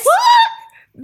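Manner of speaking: piercingly high, loud yelp; real burst of astonishment; shocked shriek. Real, not acted. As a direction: shriek it in utter disbelief. Reacts to the cliffhanger.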